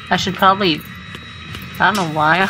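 usual speaking voice

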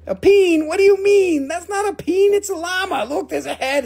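high, loud disbelief; incredulous, pitched-way-up objection; indignant protest. Real, not acted. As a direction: protest it in shrieky mock outrage. Protesting viewer's misreading of llama.

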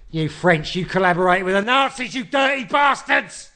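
high, loud mock outraged yell; mock rant. Host yelling at the French, in mock loud outrage. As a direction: belt it out in mock fury.

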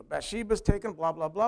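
ordinary talk

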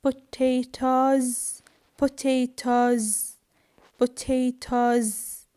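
slow "Potatoes" with a voiced z ending; final S as Z. Shown as a demonstration. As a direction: stretch each "Potatoes" into a buzzing z. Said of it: "I pronounce the S".

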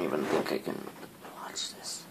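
frightened whisper; hushed and scared. Real, not acted. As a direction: breathe the words out in fear. Scared while watching horror.

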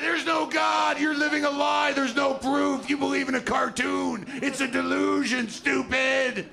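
high, loud shouted attack; high, loud shouting atheist ambushing a passerby. Retold or imitated. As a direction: leap out and yell it at him. Atheist jumping out of bushes.

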